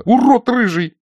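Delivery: high, loud, upset cry; tearful angry insult. A narrator's reading. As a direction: fling the insult in a shrieked outburst. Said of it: "всхлипнула Нина". (обиженный злой выкрик)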